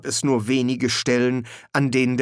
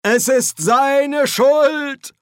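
much higher, louder, slow wail; Dean wailing; 7 dB louder, much slower than usual. A narrator's reading. The Dean wails that it is his fault, slow howl. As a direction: wail the blame out. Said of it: "heulte der Dekan".

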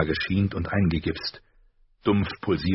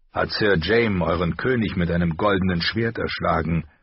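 plain reading voice

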